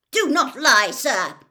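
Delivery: very loud, furious command; angry shout. A narrator's reading. Mister Crouch rejects the boy's denial, angry shout. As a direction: shout the accusation with force. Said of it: "shouted Mister Crouch".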